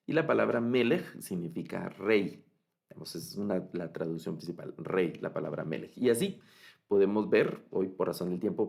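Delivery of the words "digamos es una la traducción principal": ordinary delivery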